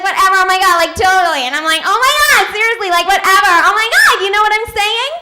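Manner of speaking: put-on loud, excitable valley-girl babble; vapid LA girl. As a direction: gush emptily like an LA party girl. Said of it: "If you notice they do this".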